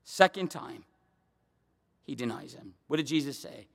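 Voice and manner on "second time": ordinary talk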